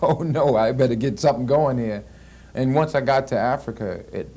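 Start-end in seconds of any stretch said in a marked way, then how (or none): 0.0-2.0 louder, higher retold worry; mock fear. Actor recalls his nerves before learning an accent, mock fear. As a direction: act out a touch of panic.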